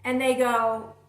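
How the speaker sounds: ordinary talk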